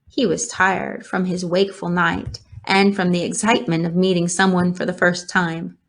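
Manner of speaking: ordinary delivery, nothing marked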